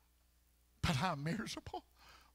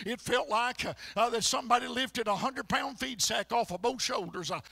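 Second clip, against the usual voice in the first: ordinary talk, nothing marked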